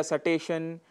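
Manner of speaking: सटेशन as "sa-teshan"; mispronunciation splitting the cluster. Shown as a demonstration. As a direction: slip a short a between s and t: सटेशन. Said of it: "जो कि गलत है". (सटेशन, स और ट अलग करके गलत उच्चारण)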